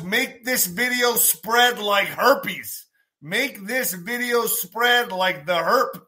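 slow, drawn-out urging; slow emphasis. Real, not acted. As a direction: stretch each word out. Drawn-out plea.

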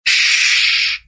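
normal talking voice

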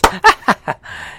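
short scornful burst of laughter; derisive laugh. Real, not acted. Laughs at absurd sentence.

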